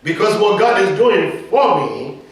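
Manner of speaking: sermon peak, drawing out the words; preaching climax. Acted. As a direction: stretch it out and build.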